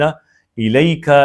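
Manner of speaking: الیکا drawn out very slowly; slow demo of ilayka. Shown as a demonstration. Teacher slowly drills الیکا for students. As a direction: stretch each syllable of الیکا. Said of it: "بالکل اعلیٰ کی طرح use ہوگا".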